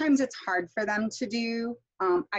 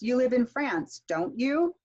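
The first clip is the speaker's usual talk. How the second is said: statement falls on "France", then "don't you" swings up; tag question intonation; 4 semitones higher than usual. Shown as a demonstration. Teacher modelling a tag question, pitch rising on the tag. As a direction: lift "don't you" higher at the end. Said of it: "And also tag questions".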